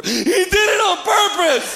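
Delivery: high, drawn-out tattletale whine; wailing little kid. Acted. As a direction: imitate a hurt child accusing someone. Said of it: "like every little kid, his first words were".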